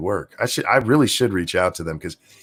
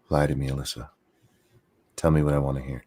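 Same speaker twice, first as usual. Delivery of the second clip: low, husky mock seduction, a bit softer; mock seductive plea; 7 semitones lower, 5 dB quieter than usual. Begging Alyssa to lie to him, in a lightly hammed seductive voice. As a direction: ham up a sultry come-on. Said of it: "No, that's horrible".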